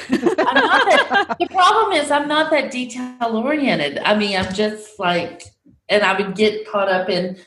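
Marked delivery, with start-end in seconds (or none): 0.1-4.0 high, laughing self-deprecation; pitched up with laughter; laughing protest. Real, not acted. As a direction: laugh through a modest excuse. Laughing off praise of narrating.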